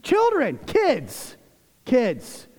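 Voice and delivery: high, pointed calling-out; chiding, emphatic address; mock scolding attack. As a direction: imitate a reproving apostle, word by word. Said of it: "This is almost an attack".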